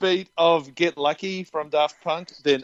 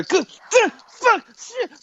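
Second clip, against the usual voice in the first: mimicked swearing outburst; slower than usual. Imitating her pained swearing outburst.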